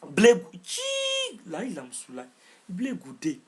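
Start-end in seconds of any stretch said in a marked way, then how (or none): 0.6-1.4 long tchip aigu entre les dents; tchip de mépris. Real, not acted. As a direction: claque la langue de mépris, très haut. Tchip de mépris sur Blé Goudé. (tchip méprisant, bien aigu)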